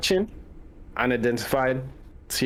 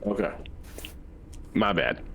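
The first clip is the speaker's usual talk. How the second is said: normal talking voice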